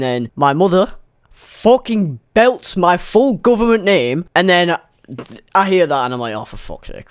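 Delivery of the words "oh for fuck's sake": hushed, breathless curse; under your breath, knowing you're caught; whispered dread. Retold or imitated. Dread on hearing mother call.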